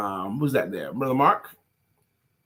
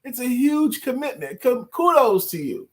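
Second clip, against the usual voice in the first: very high-pitched, effusive praise; excited reading aloud; 12 semitones higher than usual. Acted. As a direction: cheer them on in a high voice. Reads viewer's congratulatory comment aloud.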